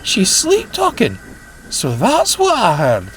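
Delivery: excited. Acted. Amused excited realisation.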